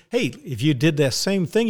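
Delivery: ordinary talk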